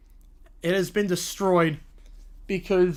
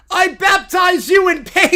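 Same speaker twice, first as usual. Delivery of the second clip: high, loud shouted blessing; hammed-up holy warrior yell; mock battle cry; 8 semitones higher, 8 dB louder than usual. Voicing bear attacking with holy water.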